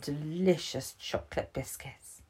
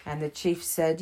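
usual speaking voice

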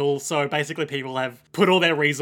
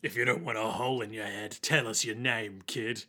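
menacing goon; 5 semitones lower, 7 dB quieter than usual. Acted. Goon threatening Jacuzzi.

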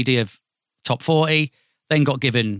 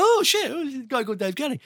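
high, strained mock disbelief; mock shocked surprise; 11 semitones higher, 4 dB quieter than usual. Hearing a rival Dave Kelly on the network line, mock shocked surprise. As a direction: act out being thrown by the name, pitched right up.

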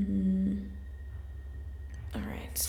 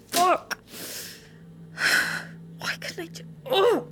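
anguished outburst; 8 semitones higher than usual. Real, not acted. Learning friend fed to ghouls.

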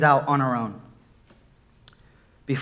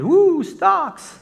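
excited; 13 semitones higher, 3 dB louder than usual. Retold or imitated. Excited thought about investing.

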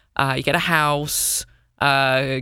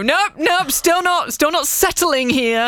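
mock defiant; 12 semitones higher, 3 dB louder, slower than usual. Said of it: "ten years' time and go".

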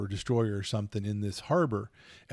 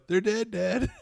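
much higher, boastful; mimicking son; 10 semitones higher, 3 dB louder, slower than usual. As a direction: brag like the kid.

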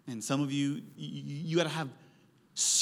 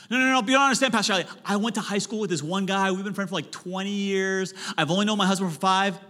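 high, loud whiny objection; protesting congregant; 4 semitones higher, 4 dB louder, faster than usual. Pretend. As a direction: whine back like a defensive listener.

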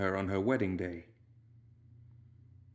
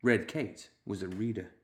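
ordinary delivery

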